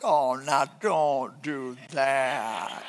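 slow, soft soothing husband; comforting plea. Pretend. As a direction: soothe her gently. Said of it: "I'm like".

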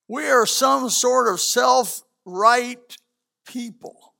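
slow, deliberate, weighted words; slow, pointed preacher emphasis. Shown as a demonstration. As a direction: drag it out to land the point. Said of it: "repeated phrase, slowed take".

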